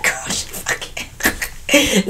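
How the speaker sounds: imitating breathless, voiceless laughter through the words; cracking up. Retelling a giggle fit with the friend, cracking up. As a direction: barely get it out for laughing. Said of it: "neighbor cracking up".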